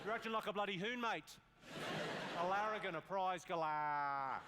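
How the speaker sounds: put-on broad Australian accent; Alf from Home and Away. Comedian impersonating an Australian soap character, broad Australian accent. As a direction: scold in Australian slang, drawing out the last words. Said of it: "I can do Alf from Home and Away".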